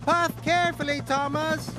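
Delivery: shouted warning. A narrator's reading. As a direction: call the warning down from the sky. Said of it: "Harold shouted".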